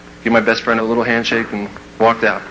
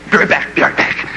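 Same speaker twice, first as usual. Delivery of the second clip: hurried whisper. Retold or imitated. Quick aside to friend.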